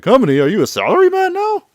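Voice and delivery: loud, very high disbelief; incredulous questioning. Retold or imitated. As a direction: let the question shoot up in mock astonishment. Yuya disbelieves Kiryu's company excuse.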